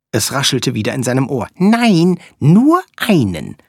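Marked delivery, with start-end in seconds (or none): 1.6-3.6 high, quick little flea voice; squeaky tiny creature. Acted. Flea character speaks.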